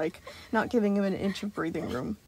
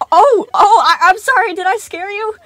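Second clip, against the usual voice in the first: high, loud stammering alarm; flustered apology; 12 semitones higher, 17 dB louder than usual. Acted. Animatronic panicking after frightening the boy, high stammer. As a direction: stumble over the words in panic.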